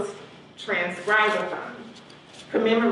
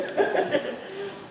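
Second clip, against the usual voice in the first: breathy high laugh; high giggle; 13 semitones higher, 4 dB quieter than usual. Real, not acted. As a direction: laugh softly, airy and high. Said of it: "poor aesthetics".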